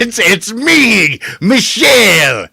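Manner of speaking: hammed-up Michelle bit. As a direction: drawl out a playful character reveal. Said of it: "He turns into Michelle again".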